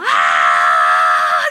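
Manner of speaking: long, full-throated scream; anguished scream. Retold or imitated. Woman crying out to God alone under the stairs, screaming. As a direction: scream at the top of your lungs. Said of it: "A grito herido".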